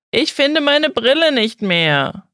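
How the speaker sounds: höher, lauter und langsam klagend; ältere Amerikanerin. A narrator's reading. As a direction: hilflos jammernde ältere Dame, gedehnt und hoch. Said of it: "eine ältere Frau, Amerikanerin". (Ehefrau klagt ihrem Mann, hoch und langsam jammernd)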